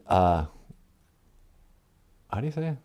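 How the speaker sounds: normal talking voice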